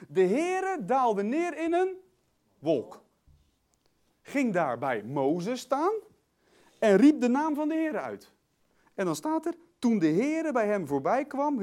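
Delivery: loud, drawn-out Bible reading; declaimed scripture. Real, not acted. Preacher taking over the reading for the congregation, declaimed scripture. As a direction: proclaim the verse with big emphasis.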